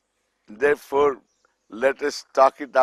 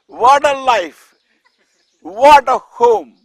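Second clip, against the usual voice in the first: mock exclamation; 8 semitones higher, 8 dB louder than usual. Mocking the feared father's home.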